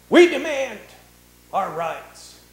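loud, high demand, falling away; mock protest cry. Preacher mocking Christians at the courthouse with a loud demand. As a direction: shout the protest, then drop off.

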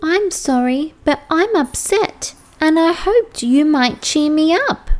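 put-on young okapi, a little upset; sad okapi voice.

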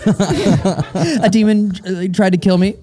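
high, giggly mock-scared delivery; imitated nervous laugh. Host teasing guest about giggling when scared, mock nervous laugh. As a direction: mimic a nervous laugh around the words. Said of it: "that little nervous laugh".

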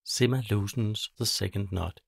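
usual speaking voice

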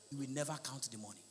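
ordinary talk, nothing marked

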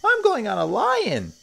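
put-on high, loud child voice; high-pitched thrilled shout; excited cry. A narrator's reading. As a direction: call out like an eager little kid. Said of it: "cried a third one".

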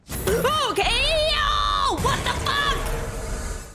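high startled yell; scared shout. Real, not acted. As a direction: shriek at the jump scare. Jump scare in horror game.

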